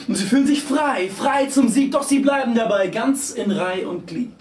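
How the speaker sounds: high-pitched, driving, breathless-sounding recitation; frenzied verse recital. A narrator's reading. Narrator swept along by screeching riders, frenzied recital. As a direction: ride the rhymes like a stampede.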